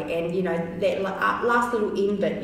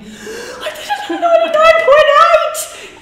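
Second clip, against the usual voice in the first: much higher and louder, laughing triumph; excited cry; 14 dB louder than usual. Retold or imitated. Telling the receptionists she broke the hundred, shouting with excitement.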